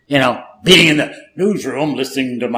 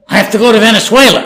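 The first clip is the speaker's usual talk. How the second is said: rushed urgency; 7 semitones higher, 6 dB louder, faster than usual. Retold or imitated. Urgent reply to his date.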